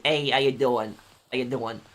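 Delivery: a New York tough-guy hello; casual New York street accent. Retold or imitated. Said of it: "Bro, can you do the Mafia accent? I can do two".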